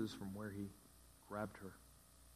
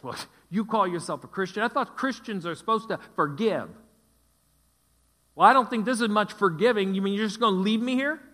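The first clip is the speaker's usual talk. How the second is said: much higher and louder mocking accusation; accusing husband; 9 semitones higher, 13 dB louder than usual. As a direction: shout a guilt trip at her, voice up high. Husband guilt-tripping wife.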